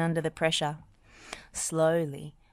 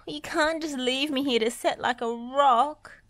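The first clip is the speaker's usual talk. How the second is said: shrieking; 9 semitones higher, 3 dB louder than usual. Acted. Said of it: "shrieked Dizzy".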